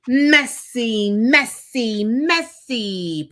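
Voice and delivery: grumbling in frustration. A narrator's reading. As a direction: grumble it, fading as you go. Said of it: "Professor Hinkle grumbled".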